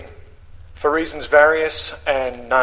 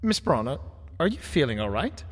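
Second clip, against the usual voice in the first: a lightly raised, polite gentleman's voice; courteous Victorian gentleman, gently concerned; Wellington's voice; 5 semitones higher, 6 dB quieter than usual. Acted. Wellington greets Miss Braun.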